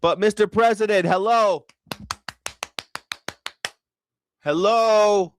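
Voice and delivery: hey, snap out of it, calling him back. Acted. Trying to interrupt Trump's rambling.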